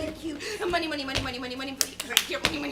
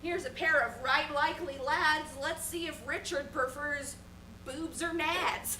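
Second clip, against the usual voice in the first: ordinary delivery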